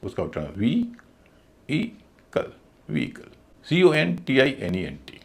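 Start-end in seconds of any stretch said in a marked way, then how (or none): 0.6-2.5 वी, ई, कल broken apart with pauses; long वी, then short ई, light कल; syllable by syllable. Shown as a demonstration. Teacher building the word for students, syllable by syllable. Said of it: "तो पहले पर stress". 2.9-3.3 व्हीकल with long "vee", light "kul"; first-syllable stress. Shown as a demonstration. Teacher joining the syllables, first-syllable stress. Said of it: "तो पहले पर stress".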